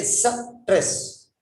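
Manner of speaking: "stress" split slowly into sa, tress; "stress" in two separate syllables; mispronounced stress. Shown as a demonstration. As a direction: slowly break "stress" after an added vowel, suh... tress. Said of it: "So they say stress".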